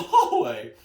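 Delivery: normal talking voice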